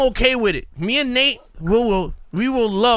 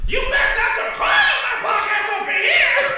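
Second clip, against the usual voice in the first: much higher, shouted angry voice; background yelling; 14 semitones higher than usual. Retold or imitated. Host imitating an angry voice in the background. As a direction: holler it from across the room. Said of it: "all you hear in the background is".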